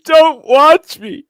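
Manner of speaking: high, loud, drawn-out mock wail; mimicked cake eater. Co-host echoes the cake story, slow high wail. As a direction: stretch it into a slow comic wail.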